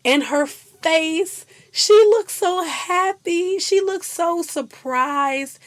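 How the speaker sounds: slow, high and loud with delight; gushing. Real, not acted. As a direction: savour every word. Gushing about happy scene.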